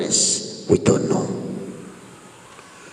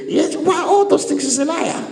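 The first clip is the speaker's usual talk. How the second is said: laughing; 13 semitones higher than usual. Real, not acted. Preacher laughing at the devil.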